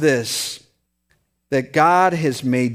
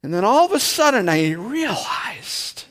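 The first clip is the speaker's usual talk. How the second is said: normal talking voice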